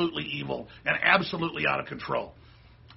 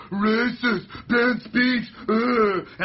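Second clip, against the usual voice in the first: loud, higher mocking whine of a protester; louder mock protester bleating slogans; mock liberal protester; 5 semitones higher, 5 dB louder, much slower than usual. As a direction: ham up a brainless chanting student. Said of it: "they just go".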